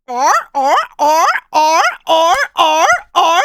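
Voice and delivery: loud barking or or; honking seal imitation. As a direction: bark like a seal. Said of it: "I can do a seal".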